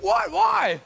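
high, loud, exasperated "why"; incredulous protest. Retold or imitated. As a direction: cry out in mock disbelief. Retold argument over tattoo idea.

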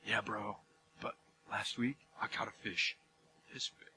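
very low, hushed mock boast; fisherman's comeback brag. Buddy answering a fish story, hushed one-upping. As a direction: top the tale in a near whisper. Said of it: "And then you're like".

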